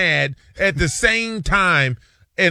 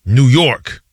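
louder, proudly announcing New York; proud declaration; 4 semitones lower, 6 dB louder than usual. Retold or imitated. Coworker answering the office pool, proudly announcing New York. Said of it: "he was like".

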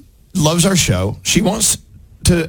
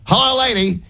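imitating Uncle Johnny; 10 semitones higher than usual. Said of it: "he used to say".

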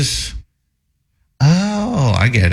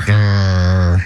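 a short, low growl like an animal; 5 semitones lower than usual. Shown as a demonstration. Host growling to help a co-host sound out a plate. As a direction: growl like a dog to give him the hint. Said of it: "what kind of a sound does an animal make".

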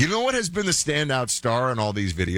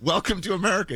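quoted tourist; 10 semitones higher than usual. Retold or imitated. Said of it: "It's like".

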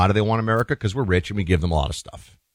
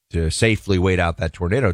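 ordinary talk, nothing marked

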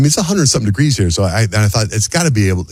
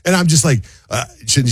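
ordinary delivery

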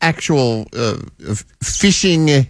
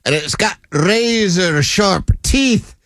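higher, drawn-out scary exaggeration; mock menace; 5 semitones higher, slower than usual. Co-host warns of the piranha's teeth, mock menacing. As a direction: ham up the danger, stretching each phrase slowly.

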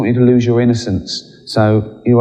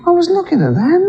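God in a squeaky high, outraged voice. Retold or imitated. God scolding Adam and Eve, squeaky and high. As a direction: ham up a shrill, scandalised God. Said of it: "He goes".

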